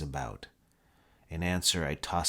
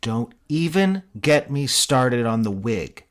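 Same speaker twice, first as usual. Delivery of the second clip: raised, exasperated complaint; building outrage over the wig; exasperated rant; 5 semitones higher, 8 dB louder, slower than usual. Acted. Mitch ranting in character.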